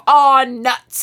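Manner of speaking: a cute fake complaint before the kiss; mock grumble. She goes to kiss him.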